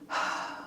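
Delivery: tired shaky sigh; a trembling exhale. Real, not acted. Tired vlogger, near tears.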